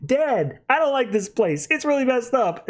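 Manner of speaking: put-on high, complaining little-boy voice; whiny kid son. Son telling his dad the place feels wrong, high and whiny. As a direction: play the uneasy young son. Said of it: "he's just like".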